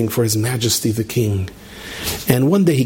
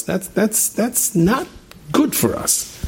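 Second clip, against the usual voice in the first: high, breathy half-whisper; preacher warning the listeners, breathy and high; breathy dismay; 10 semitones higher, much slower than usual. Real, not acted. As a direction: let the words fall away in dismay.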